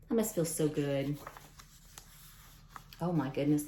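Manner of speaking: ordinary delivery, nothing marked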